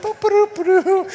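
high, loud "ba-doop" babble; carefree toddler noises. Retold or imitated. Daughter wanders off with the parade, high bouncy nonsense sounds. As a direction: imitate a little girl bopping happily along. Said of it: "she just was like".

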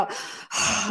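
imitating a parent's stressed sigh; copied deep sigh. Parent mimicking young son, mock deep sigh. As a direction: mimic a heavy, breath-only sigh. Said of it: "He does the same, like, deep sigh".

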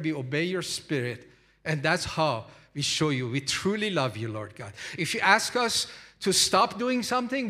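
loud, high-pitched preaching prayer; preacher leading the church in prayer; fervent prayer. Acted. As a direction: pray out with full force.

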